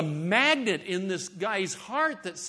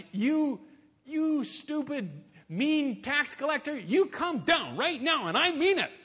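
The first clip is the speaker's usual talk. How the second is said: high, shrill-free mock scold, much higher; 10 semitones higher than usual. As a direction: playfully scold like a cross Sunday school kid. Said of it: "You get to scold Zacchaeus".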